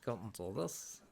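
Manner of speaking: put-on silly voice, swooping up and down; silly little voice. Shown as a demonstration. Streamer showing viewers a voice everybody can do, silly little voice. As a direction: slip into a daft little character voice. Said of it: "silly little voice that they can do".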